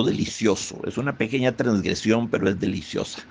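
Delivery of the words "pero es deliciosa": ordinary delivery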